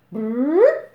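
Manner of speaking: horn blast imitation. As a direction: blow it like a war horn.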